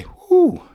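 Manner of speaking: high, loud puff of exertion; mock winded whew. Shown as a demonstration. Teacher acting winded after stairs, exaggerated whew. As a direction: blow out a demonstrative "whew".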